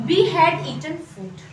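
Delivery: very slow, word by word for the class; slow dictation. Shown as a demonstration. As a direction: spell it out slowly so students can follow. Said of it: "तो हम लोग बोलेंगे".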